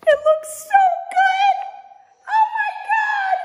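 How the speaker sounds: very high, loud, slow joyful cries; thrilled shout. Real, not acted. Hanging the poster, very high joyful shouting. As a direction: shout with delight, drawn out.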